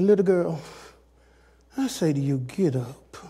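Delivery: low, hushed, tender summons; gentle quiet command. Retold or imitated. Jesus talking to the little girl, gentle quiet command. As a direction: softly call her to rise, voice dropping.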